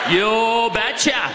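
put-on slow, drawn-out Norwegian accent; a light Norwegian father impression. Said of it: "in his thick Norwegian accent".

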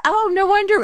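high sung-out oh; mock realization. Guard's realization retold.

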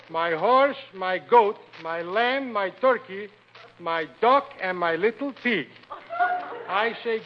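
slow, halting schoolbook reading; primer read-aloud. Acted. Man reading his second reader aloud, slow and deliberate. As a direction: read it out like a proud beginning reader.

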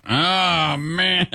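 drawn-out high "oh man"; dismayed groan. Retold or imitated. Retelling own dismayed reaction.

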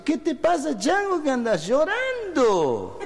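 high, stretched provincial cry; alarmed friend, hammed-up. Santiagueño asking his weeping friend, high hammed-up cry. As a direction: wail the question, drawing out the last word.

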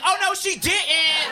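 drawn-out Maury audience outrage; sassy talk-show gasp. Retold or imitated. Comic imitating a Maury audience member, sassy and high. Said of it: "I'm like".

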